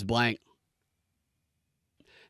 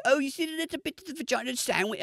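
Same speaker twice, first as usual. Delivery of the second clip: high-pitched mock girly voice; mock girls; 11 semitones higher, 4 dB quieter, faster than usual. Retelling what the girls on the show say, high mock voice. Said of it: "literally the girls are like".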